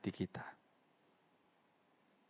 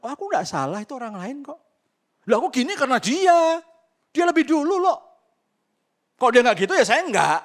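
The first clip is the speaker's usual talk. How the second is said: mock indignant self-defence, building from higher to a much higher, louder shout; proud excuse-maker; 13 semitones higher, 12 dB louder than usual. Preacher mimicking a proud churchgoer's excuses, rising indignant shout. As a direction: play the prideful sinner blaming others, rising to a whiny yell. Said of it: "kesombongan kita mengatakan".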